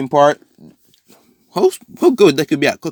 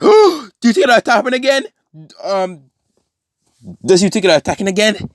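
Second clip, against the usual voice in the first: eager high voice; 9 semitones higher, 5 dB louder than usual. Acted. Carter voiced eagerly in retold story.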